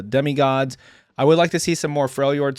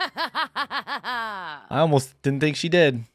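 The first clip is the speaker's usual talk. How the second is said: laughing; 5 semitones higher, much slower than usual. Real, not acted. Reacting to a clip, amused.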